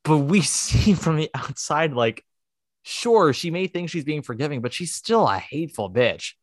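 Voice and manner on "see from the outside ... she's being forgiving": ordinary delivery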